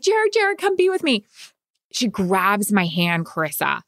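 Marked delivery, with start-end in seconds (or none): 0.0-1.2 high urgent plea to her husband; urgent calling. Retold or imitated. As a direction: call him over, much higher and pleading. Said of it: "And then I'm like".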